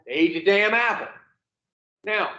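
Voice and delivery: ordinary talk, nothing marked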